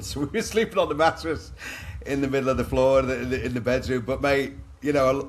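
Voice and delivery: amused, laughing while retelling; laughing through it. Real, not acted. Laughing recalling sleeping on mattress.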